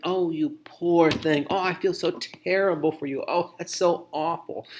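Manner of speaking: high, put-on pitying voice; mock sympathy. Poor listener pitying a person, exaggerated mock sympathy. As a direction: gush with hammed-up sympathy, drawing out the start. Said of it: "sympathizing with them".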